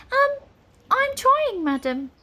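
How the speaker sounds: high-pitched, protesting wizard; flustered wizard. A narrator's reading. Wizard answers Nine's order, flustered. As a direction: protest helplessly, pitched right up.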